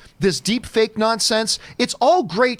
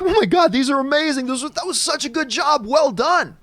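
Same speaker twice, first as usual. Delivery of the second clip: imitating gushing friends; 5 semitones higher than usual. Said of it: "watched them like".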